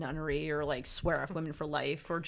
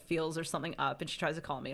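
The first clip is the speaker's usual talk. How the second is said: usual speaking voice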